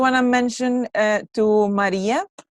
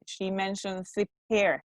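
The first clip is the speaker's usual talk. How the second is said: usual speaking voice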